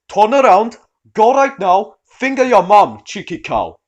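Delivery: put-on loud, gruff thick Welsh accent. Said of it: "in the thickest Welsh accent".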